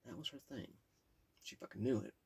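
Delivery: ordinary delivery, nothing marked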